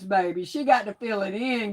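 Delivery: ordinary talk, nothing marked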